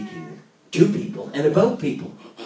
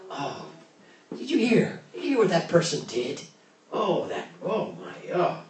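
mock superior gossip, higher on did you hear; snarky gossip; 6 semitones higher than usual. Preacher mimics a snarky gossip to the congregation, mock superior. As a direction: imitate a smug gossip spreading news. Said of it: "a snarky voice".

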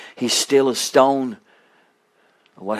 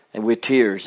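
ordinary talk

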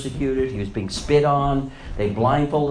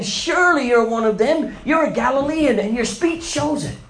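high, loud insistence; bystanders accusing; 13 semitones higher, 4 dB louder than usual. Acted. Bystanders pressing Peter about his accent, loud and high. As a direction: corner him with it. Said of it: "those who stood by said to Peter".

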